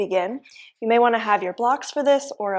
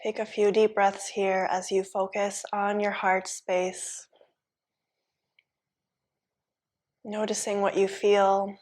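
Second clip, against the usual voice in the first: soft, even yoga-teacher voice; hushed, level meditation guidance; guided relaxation; 6 dB quieter than usual. Real, not acted. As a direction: ease them into stillness, softly, with long pauses. Leads guided relaxation in pose.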